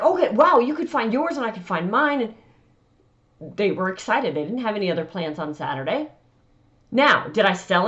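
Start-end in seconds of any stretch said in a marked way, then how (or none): 0.0-2.2 retold excitement. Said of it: "They were like".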